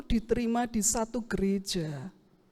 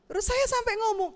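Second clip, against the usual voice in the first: usual speaking voice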